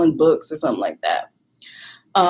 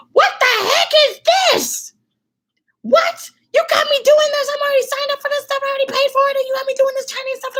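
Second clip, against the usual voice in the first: much higher, wailing, tearful, rushed complaint; tearful high-pitched wail; faster than usual. Retold or imitated. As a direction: whine to your friend in near tears, pitched way up. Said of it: "I called Andrea and I said".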